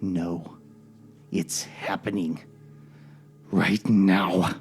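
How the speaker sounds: slow, measured mysterious speaker; a lightly voiced figure settling an argument; controlled voice. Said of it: "say in a controlled voice".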